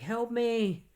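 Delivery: high-pitched mock plea for help; retold crying plea. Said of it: "she's, like, crying".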